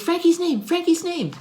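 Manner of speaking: high, excited puppy voice; high puppy chant; dog voice. Acted. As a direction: squeak it like an eager little dog. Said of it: "He says".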